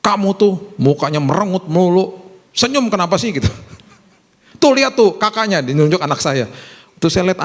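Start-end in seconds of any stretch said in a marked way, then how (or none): 0.0-3.3 higher-pitched retold scolding; mock cross telling-off; scolding parent. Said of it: "dia marah-marah sama anaknya". 4.6-5.6 higher-pitched retold scolding; mock cross telling-off; scolding parent. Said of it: "dia marah-marah sama anaknya".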